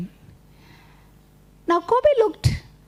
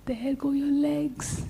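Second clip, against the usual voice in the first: sly aside whisper; 7 dB quieter, slower than usual. Acted. Tortoise counts spider's legs.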